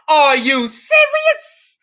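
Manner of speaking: slow, stretched incredulous cry; are you serious, drawn out; mock disbelief. Real, not acted. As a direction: drag it out in disbelief. Catchphrase with laughter.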